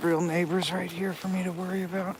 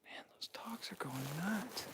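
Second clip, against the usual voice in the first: whispering; 12 dB quieter than usual. Real, not acted. Whispering in the dark woods.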